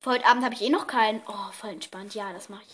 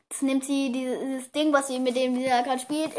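usual speaking voice

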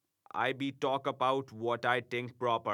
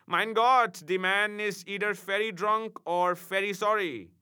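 put-on soothing German-accented imp, slower; softened, consoling; 7 semitones higher than usual. A narrator's reading. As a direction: gently coax him to stop crying. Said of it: "much softened at my distress".

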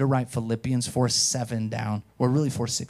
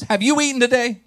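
wife's voice; 9 semitones higher, 6 dB louder than usual. Retold or imitated. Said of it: "She goes".

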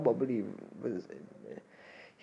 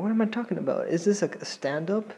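normal talking voice

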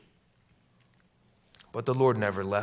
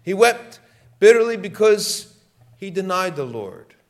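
much higher, much louder anguished cry; preaching cry; 8 semitones higher, 10 dB louder than usual. Real, not acted. Preacher crying out Peter's grief, high and loud. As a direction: cry it out at the peak.

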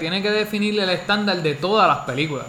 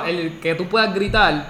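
ordinary talk

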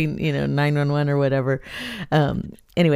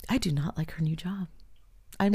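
hushed, breathy mock-sulk, much softer; whispered complaint; 11 dB quieter than usual. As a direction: whisper the grievance like a sulking secret. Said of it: "and I was like".

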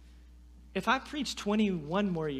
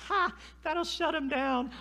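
smug laugh; 10 semitones higher than usual. Retold or imitated. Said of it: "I'm like".

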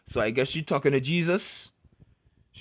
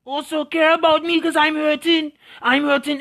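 whiny hurt man; 10 semitones higher, 5 dB louder than usual. Retold or imitated. Voices a self-pitying hurt man.

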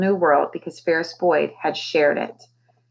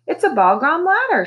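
high delighted exclaim; girl exclaiming at a hidden ladder; 10 semitones higher, 3 dB louder than usual. A narrator's reading. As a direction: burst out with discovery. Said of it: "she exclaimed".

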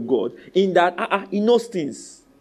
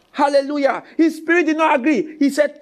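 ordinary talk, nothing marked

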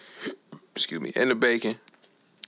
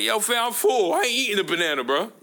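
protesting refusal; 11 semitones higher than usual. Retold or imitated. Acts out refusing the banana.

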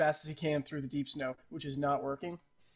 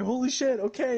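startled compliance; 8 semitones higher, 4 dB louder than usual. Acted.

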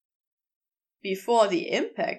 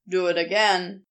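lower, firmer man's voice; a lower Crowley, teasing and sure; Crowley's voice; 5 semitones lower than usual. Acted. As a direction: drop low and speak with easy authority.

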